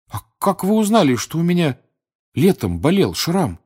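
high, loud astonished question; amazed boy, voice jumping up; unrestrained amazement. A narrator's reading. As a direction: blurt it out in surprise. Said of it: "не сдержал Гарри изумления".

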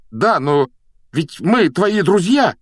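high, loud pleading objection; worked-up appeal to a friend; exasperated protest. A narrator's reading. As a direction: protest heatedly, stretching the words. Ron protests in the quarrel.

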